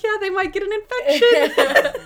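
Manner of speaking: high, loud mimicry breaking into laughter; laughing, high-pitched imitation; giddy banter. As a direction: retell the joke high and laughing.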